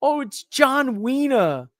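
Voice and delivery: high, slow mock British quip; a lightly hammed-up Wade impression; imitating Wade. Commentator Wade punning on air, high and slow. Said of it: "Wade going".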